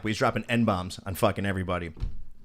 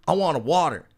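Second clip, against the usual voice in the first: higher, louder mock demand; belligerent demand; 7 semitones higher, 6 dB louder than usual. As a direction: imitate a stubborn drunk-style demand. Retold belligerent man making a demand.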